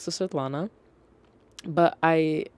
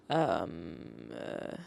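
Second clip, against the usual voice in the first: low, drawn-out um and uh; imitated blank hesitation; 11 semitones lower, 9 dB quieter than usual. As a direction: imitate someone standing stuck, low and trailing off. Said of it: "just stands there like this".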